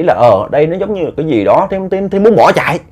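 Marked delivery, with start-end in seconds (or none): none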